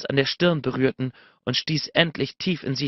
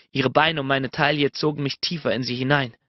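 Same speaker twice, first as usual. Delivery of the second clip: ordinary delivery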